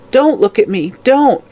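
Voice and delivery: put-on frightened old woman's protest; acted anguished cry; hag's cry. A narrator's reading. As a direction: jump high on the last word. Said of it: "cried the hag".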